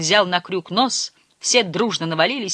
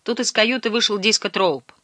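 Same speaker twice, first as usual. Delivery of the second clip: ordinary delivery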